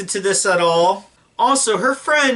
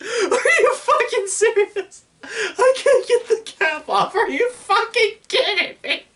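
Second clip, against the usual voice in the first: real helpless high-pitched laughter through the words; disbelieving, words breaking up into laughter; hysterical laughing. Real, not acted. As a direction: squeal it out while cracking up. Reacting to bad film line, hysterical laughter.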